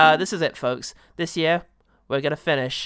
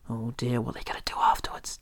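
whisper; 3 semitones lower, 6 dB quieter than usual. Pretend. Mock worried aside.

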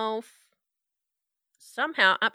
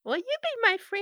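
put-on creepy little girl=85; 12 semitones higher than usual.

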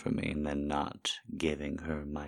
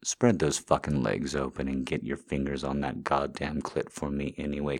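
ordinary talk, nothing marked

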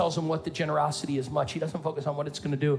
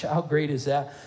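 ordinary talk, nothing marked

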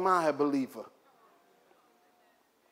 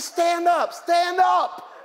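loud exasperated shout of stand up; husband shouting to panicking wife in pool; shouted instruction; 11 semitones higher, 5 dB louder, much slower than usual. Retold or imitated. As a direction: yell at her to just stand. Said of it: "I said".

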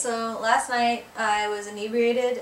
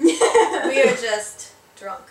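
put-on slow, laughing French accent, louder; 4 semitones higher, 9 dB louder, much slower than usual. Woman retelling a drunk Frenchman, slow laughing French accent. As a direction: laugh through a mock French accent, drawn out. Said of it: "really strong French accent".

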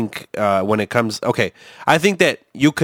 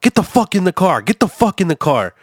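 raised, higher-pitched imitation of a screaming man; retold angry yell; 8 semitones higher than usual. Guy screaming at the kid in the parking lot, retold raised yell. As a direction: mimic an angry man ordering a kid, without full volume. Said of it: "I hear the guy screaming at the kid".

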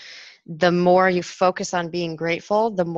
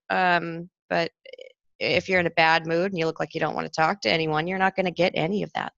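ordinary talk

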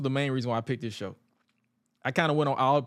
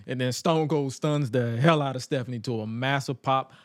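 normal talking voice

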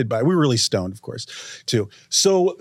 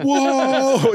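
long, high-pitched amazed "whoa"; awed whoa; 11 semitones higher than usual. Retold or imitated. Friend re-enacts looking at each other after the film, awed. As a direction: stretch it out high in wonder. Acting out their stoned amazement.

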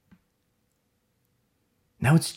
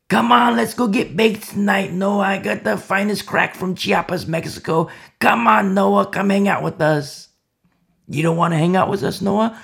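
a hint of a teasing partier, higher and louder; taunting party crowd; 6 semitones higher, 3 dB louder than usual. Scoffers coaxing Noah to party, lightly mocking. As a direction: lure him out with a mocking grin.

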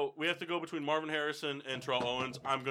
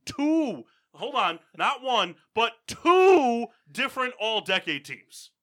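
rising, louder, slowing to stress it; emphatic; 7 semitones higher, 8 dB louder, much slower than usual. Real, not acted. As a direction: insist hard, drawing it out. Passionate defense of a player.

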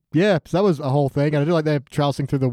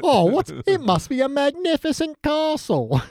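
high, slow, hammed-up British voice; mock British accent; 11 semitones higher, slower than usual. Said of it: "just the accent we use".

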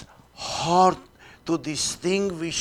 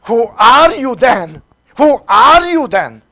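louder, higher demanding question; 6 semitones higher, 13 dB louder than usual. Acted. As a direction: press him for an answer. Crowd's question, voiced in sermon.

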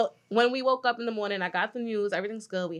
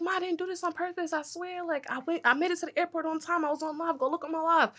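pleading; 6 semitones higher, 3 dB quieter than usual. Retold or imitated. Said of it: "I'm like".

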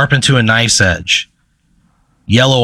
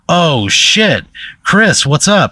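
higher-pitched, pleased greeting; a touch of surprise, pitched up for a pal; excited greeting; 6 semitones higher, much slower than usual. Acted. Bar Dog spots his friend Chris, excited greeting.

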